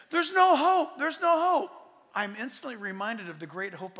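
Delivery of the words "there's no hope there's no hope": high, loud mock cry of doom; mock alarmed world. Pastor imitating the hopeless world, in mock loud alarm. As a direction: voice the world's despair, pitched up and loud. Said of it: "the things that the world says".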